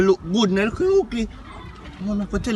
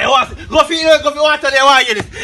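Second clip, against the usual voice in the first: shouting; 4 semitones higher, 6 dB louder than usual. Acted. Shouting at someone over water.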